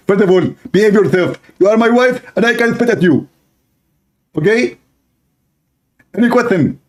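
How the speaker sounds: husband scolding his wife; bossy husband. Acted. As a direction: put her in her place, mockingly.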